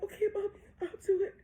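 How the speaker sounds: put-on high, crying little-girl reply; tearful child. Daughter promises mommy she will do it, crying. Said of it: "She's crying".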